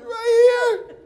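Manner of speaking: high, loud tearful shout; hammed-up crying at its peak; bawling boy. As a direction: wail it like a hurt child. Crying boy shows the hurt spot.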